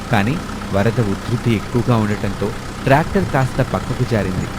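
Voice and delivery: plain reading voice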